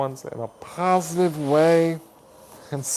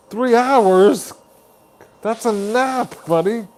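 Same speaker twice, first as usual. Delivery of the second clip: incredulous; 6 semitones higher, 4 dB louder than usual. Real, not acted. Incredulous at viewer's bedtime.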